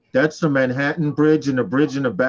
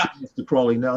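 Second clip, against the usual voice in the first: ordinary talk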